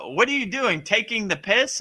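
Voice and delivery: a hint of an English local asking if you're joking; imitating English locals. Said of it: "they were like".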